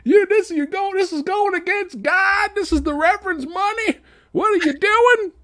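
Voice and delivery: high, put-on voice of a scolding religious lady; mock churchy lady. Friend mocks the preachy store lady, high put-on scold. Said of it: "The lady's super religious now".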